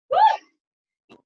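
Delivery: high, loud "woo"; excited whoop. Real, not acted. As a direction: whoop to cheer them on. Cheering on the workout.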